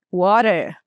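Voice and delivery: water with the T as a quick flap like R; American flap T. Shown as a demonstration. Teacher showing how the American says water. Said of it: "o americano vai falar como".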